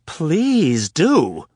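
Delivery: high, loud catty sneer; extra catty. Acted. Anna daring Rapp to explain, catty and loud. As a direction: drip sarcasm. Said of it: "In an extra catty tone".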